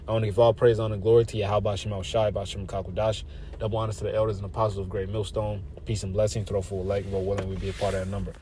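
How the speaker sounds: low, soft closing praise; recited sign-off. Real, not acted. Preacher closing with praise and honors, low recited sign-off.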